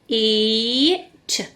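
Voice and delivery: normal talking voice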